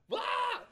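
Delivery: sudden loud, very high mock scream; hammed-up startled scream; frightened scream. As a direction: shriek in comic terror. Acts out fright at creepy man.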